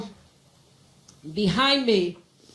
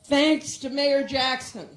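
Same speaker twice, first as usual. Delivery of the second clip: ordinary talk, nothing marked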